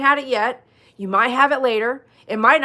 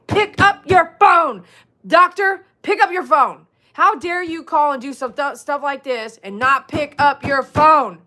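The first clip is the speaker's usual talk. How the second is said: furious shout, higher and louder, slowed; angry yelling; 6 semitones higher, 6 dB louder than usual. Acted. Frustrated patient shouting at the doctor for not picking up. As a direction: scream down the phone at the doctor.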